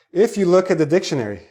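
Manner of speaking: usual speaking voice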